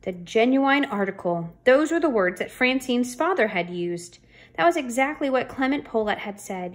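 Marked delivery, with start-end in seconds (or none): none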